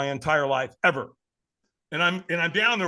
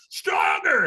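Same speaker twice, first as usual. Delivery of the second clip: high-pitched mock scream in the face; retold scream; 6 semitones higher, 4 dB louder than usual. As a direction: imitate a trainer screaming for more. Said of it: "he screamed at me".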